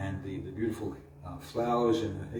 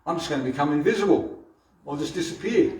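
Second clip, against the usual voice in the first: put-on high, louder, quick boast of a boy about to vanish; a boy sure his trick will work; smug child Krishna, rushed; boastful child; 6 semitones higher, 8 dB louder, faster than usual. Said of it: "she thinks she got me".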